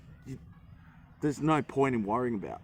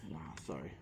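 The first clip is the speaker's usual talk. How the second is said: normal talking voice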